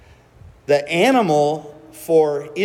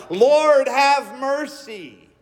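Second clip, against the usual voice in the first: a high, loud cry for mercy, slowing down at the end; desperate shout; 8 semitones higher, much slower than usual. Retold or imitated. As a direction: cry out to the Lord like a foreign mother begging. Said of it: "yelling, screaming out loud".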